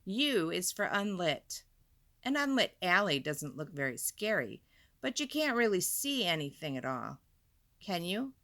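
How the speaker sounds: ordinary delivery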